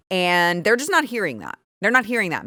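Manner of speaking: ordinary talk, nothing marked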